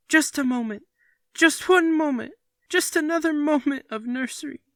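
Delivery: put-on high, loud pleading child's wail. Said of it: "they wailed".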